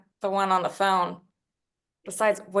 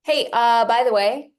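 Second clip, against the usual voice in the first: calling out; 3 semitones higher, 9 dB louder than usual. Acted. Calling after him as he leaves.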